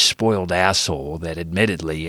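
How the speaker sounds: ordinary delivery, nothing marked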